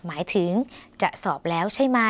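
ordinary delivery

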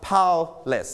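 "powerless" split in two, weight on the first syllable; stress on first syllable. Shown as a demonstration. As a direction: model "powerless" syllable by syllable, first one strong. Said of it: "ลงเสียงหนักที่พยางค์ที่หนึ่ง".

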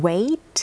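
wait, model pronunciation with a clean final t; base verb said clearly. Shown as a demonstration. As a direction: say wait as a one-syllable model. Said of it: "กริยาตัวใดนะคะ ที่ลงท้ายด้วยเสียง t". (ออกเสียง wait ชัดๆ เป็นตัวอย่าง)

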